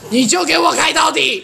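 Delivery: fed-up insistence, played for laughs; mock exasperation. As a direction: lay down the law, half laughing. Acted exasperated order to the driver.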